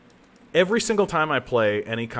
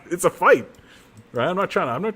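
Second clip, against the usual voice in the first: emphatic; 8 semitones higher, 4 dB louder, faster than usual. Real, not acted. Heated defense of cheap tactics.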